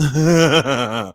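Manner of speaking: loud cackling burst of laughter. Real, not acted. Host cracking up at their own joke, cackling laugh.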